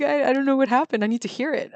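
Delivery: put-on panicked that the accent won't come; flustered. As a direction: sound flustered, like you've lost the accent. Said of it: "And I'm like".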